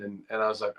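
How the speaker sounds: ordinary delivery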